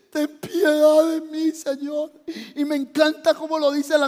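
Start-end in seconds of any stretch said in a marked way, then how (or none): 0.1-2.1 drawn-out, higher crying prayer; tearful plea. Acted. Preacher as Ezequías begging God, acted crying. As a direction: plead for mercy through acted tears. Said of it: "empezó a llorar y le dijo".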